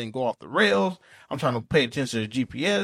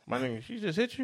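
higher, much softer, incredulous; hushed mock disbelief; 4 semitones higher, 9 dB quieter than usual. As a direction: ask it like a stunned friend. Imitated bystander reaction.

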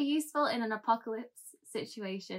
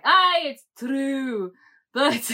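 slow, loud, grand mock-Scottish; exaggerated Scottish accent; 5 semitones higher, 9 dB louder, much slower than usual. Leader answering frightened crowd from podium, exaggerated Scottish accent. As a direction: proclaim it as a caricature Scot. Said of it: "this accent that I've been working on".

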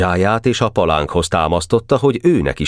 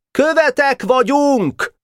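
high, slow, drawn-out call; calling from afar; slower than usual. A narrator's reading. Szebenics calls from afar to Boka, waving a flag. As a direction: call out to be heard from far away. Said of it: "Már messziről kiáltotta".

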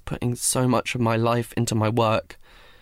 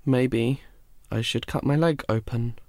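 plain reading voice

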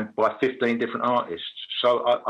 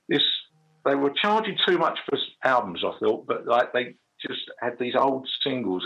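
ordinary talk, nothing marked

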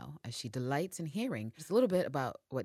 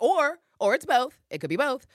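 smug quip; 11 semitones higher, 11 dB louder than usual. Retold or imitated. Constantine's sly quip.

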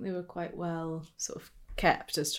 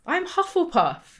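proud announcement; 12 semitones higher, 6 dB louder, much slower than usual. Real, not acted. Proudly announces own house.

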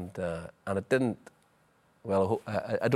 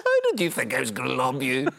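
raised, mocking question; crowing over a beaten opponent; retold jeer; 4 semitones higher, 4 dB louder than usual. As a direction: rub it in, higher and louder.

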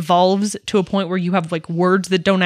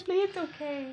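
soothing the dog; 8 semitones higher, 12 dB quieter than usual. Real, not acted.

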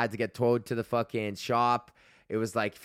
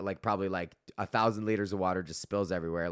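ordinary talk

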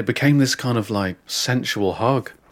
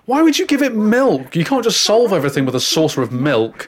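exasperated mum; 8 semitones higher, 4 dB louder than usual. Retold or imitated. Mum scolding stepdad.

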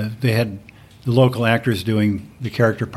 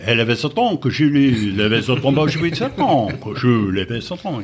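mock French gibberish, lightly done; sing-song fake French nonsense; 2 semitones higher than usual. Shown as a demonstration. Comic French gibberish for the audience. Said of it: "French gibberish".

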